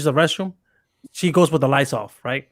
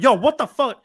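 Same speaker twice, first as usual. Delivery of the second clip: startled scream; 7 semitones higher than usual. Retold or imitated. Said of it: "I scream like".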